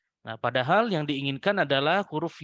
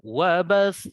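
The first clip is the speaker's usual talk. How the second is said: wabas with the voice lifted at the end; raised ending; 3 semitones higher, 5 dB louder than usual. Shown as a demonstration. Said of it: "Jadi kembali lagi".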